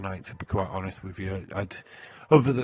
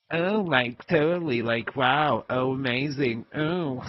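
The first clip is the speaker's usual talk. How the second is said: higher, louder mock American mean girl; Mean Girls talk; 4 semitones higher, 5 dB louder than usual. Mocking how a disliked wrestler talks, in Mean Girls voice. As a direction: gush like a movie mean girl. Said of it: "Mean Girls talk".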